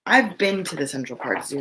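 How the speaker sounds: ordinary delivery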